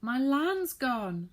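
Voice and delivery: very high, loud, slow wail; shriek. A narrator's reading. Saucepan man finding his land gone, shrieking high and slow. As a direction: shriek it out in shock. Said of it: "shrieked the saucepan man".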